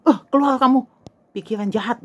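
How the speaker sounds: acted scolding, hard on the first words; stern rebuke. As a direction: cast out a bad thought. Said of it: "terus saya tengking-tengking".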